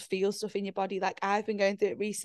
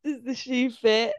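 slower and a bit louder, spelling it out; drawn-out teasing repeat; slow, deliberate repeat; 3 semitones higher, 3 dB louder, slower than usual. Real, not acted. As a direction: repeat it slowly so she hears. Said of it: "What did you say?".

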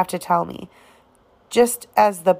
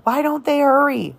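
higher, more desperate second time; more urgent repeat; 7 semitones higher than usual. A narrator's reading. Jem repeats to himself, watching the fire, more desperate. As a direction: push the repeat harder. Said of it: "muttered Jem".

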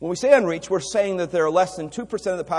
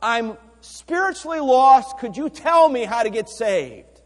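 slow, high, loud plea; a lost man asking to be saved; pleading stranger; 9 semitones higher, 8 dB louder than usual. Pretend. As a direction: beg a passerby for help. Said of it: "I could say".

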